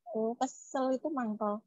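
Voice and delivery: usual speaking voice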